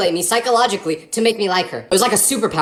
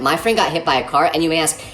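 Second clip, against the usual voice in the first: ordinary delivery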